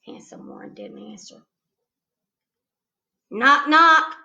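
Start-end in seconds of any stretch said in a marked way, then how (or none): 3.3-4.2 louder knock, knock; a little louder. A narrator's reading. Girl repeating her joke louder to the boy. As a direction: call it out again, insistent. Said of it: "I said a little louder".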